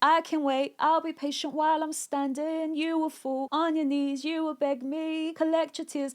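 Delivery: high-pitched, defiant lyric voice; song persona, defiant. Acted. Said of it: "in the chorus, when I'm like".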